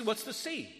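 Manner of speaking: ordinary talk, nothing marked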